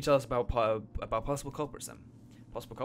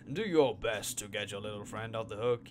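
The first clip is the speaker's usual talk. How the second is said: ordinary talk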